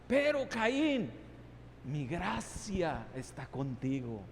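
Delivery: high, loud, slow divine voice; God rebuking Cain. Acted. God telling Cain he gives no place but grace, loud and firm. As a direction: speak as God, firm then tender. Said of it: "Dios le estaba diciendo".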